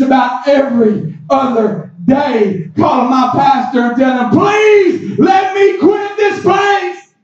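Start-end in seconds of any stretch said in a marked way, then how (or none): 4.3-7.1 high, slow, begging plea; pleading. Retold or imitated. Calling the pastor on lunch break, pleading to quit. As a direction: beg to be let go, drawn out and high.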